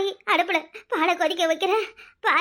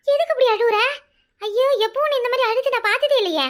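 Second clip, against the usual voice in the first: alarmed concern; 5 semitones higher, 4 dB louder than usual. Acted. Friend alarmed at her crying.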